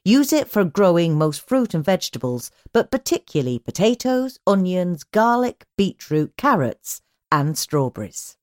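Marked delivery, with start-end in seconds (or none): none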